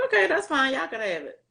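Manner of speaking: high, breezy retold agreement; like it's nothing. Teen agreeing to give aunt the baby, like it's nothing. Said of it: "I'm talking like it's nothing".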